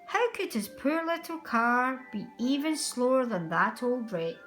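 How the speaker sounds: ordinary delivery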